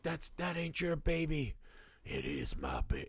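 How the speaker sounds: a hint of a stubborn mother insisting; imitating the mother. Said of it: "when she's like".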